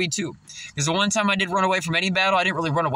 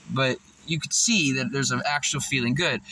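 ordinary talk, nothing marked

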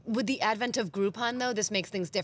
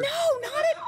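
high protest; 4 dB louder than usual. Real, not acted. Denying old man dater charge.

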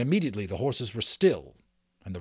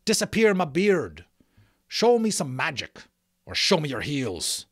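loud, raised rustic outlaw taunt; booming challenge; 5 semitones higher, 5 dB louder than usual. A narrator's reading. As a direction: dare the magician with a booming voice. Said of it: "he boomed".

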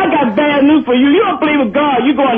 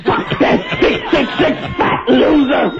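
furious rant. Real, not acted. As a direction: roar it out, hating every word.

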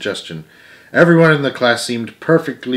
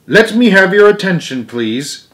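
raised commanding teacher voice; Stuart addressing the class; 8 semitones higher, 6 dB louder than usual. A narrator's reading. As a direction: call the class to order, up high. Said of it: "and said".

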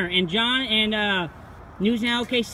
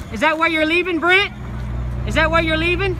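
higher and louder, jeering; taunting shout; 6 semitones higher, 6 dB louder than usual. Real, not acted. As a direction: shout the taunt after him.

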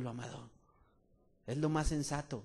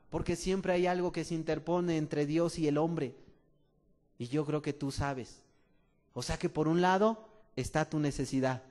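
ordinary talk

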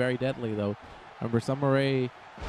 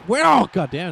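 louder, higher startled curse; shocked outburst; 6 semitones higher, 10 dB louder than usual. Real, not acted. Commentator reacting to a sudden power bomb, louder and shocked. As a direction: blurt it out as the move lands.

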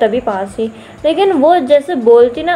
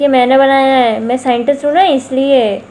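ordinary talk, nothing marked

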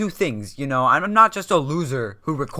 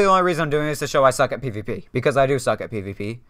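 usual speaking voice

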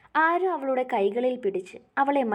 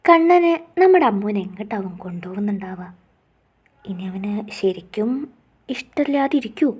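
teaser outro; 7 dB louder than usual. A narrator's reading. Narrator teases next episode.

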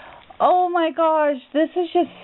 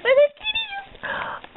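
much higher, slow, put-on cutesy voice; gushing over cat stickers in a squealy voice; slower than usual. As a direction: coo it in a silly high voice. Delighted at kitty stickers.

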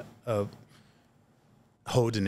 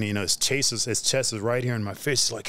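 ordinary talk

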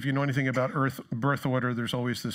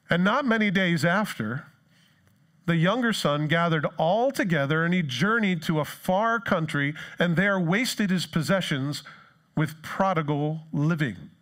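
slow, raised Bible reading; scripture reading; 4 semitones higher than usual. Acted. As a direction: read the verse aloud to the church.

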